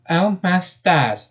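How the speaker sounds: high, slow southern amazement; drawn-out amazed southern exclamation. Acted. Rabbit astonished at her punch, amazed. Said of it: "she said with a tone of amazement".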